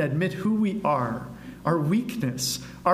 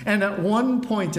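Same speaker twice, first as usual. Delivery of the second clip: usual speaking voice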